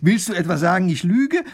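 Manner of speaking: high, loud, offended challenge; bristle, pitch leaping on the question; agitated indignation. A narrator's reading. Wife challenging her doubting husband, indignant. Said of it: "wird die Frau noch aufgeregter".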